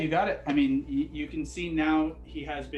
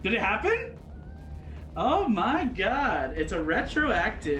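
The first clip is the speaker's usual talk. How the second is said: surprised; 7 semitones higher, slower than usual. Real, not acted. Surprised at the magic surge.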